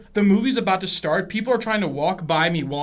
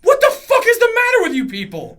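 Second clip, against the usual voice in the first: loud, high-pitched hammed-up yell; over-the-top outraged shout; furious exasperation; 13 semitones higher, 9 dB louder than usual. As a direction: explode at their stupidity. Acted argument reaches its peak.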